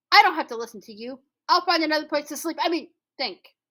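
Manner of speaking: quick and huffy. A narrator's reading. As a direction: storm off in a huff. Anansi stomps off annoyed.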